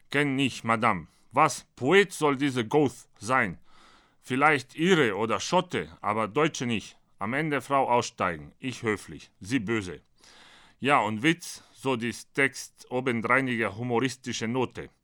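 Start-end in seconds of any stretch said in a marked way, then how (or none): none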